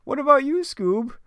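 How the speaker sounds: very high, cracking cartoon slacker voice; Shaggy. Shaggy asking his dog about food, very high put-on voice. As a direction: squeak it out way up high. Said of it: "And Shaggy's like".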